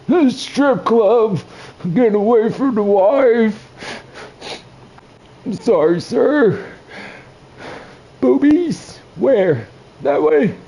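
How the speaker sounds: put-on high, slow, dotty old man voice; confused old man. Old man answering a cop, high and dotty. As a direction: play a muddled old man. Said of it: "I'm gonna be that old man".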